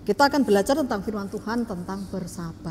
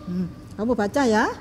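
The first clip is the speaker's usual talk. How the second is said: usual speaking voice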